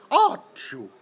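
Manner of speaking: loud mock sneeze; Finnish sneeze. Imitating how a Finn might sneeze, loud mock sneeze. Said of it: "if you're from Finland, do you sound like".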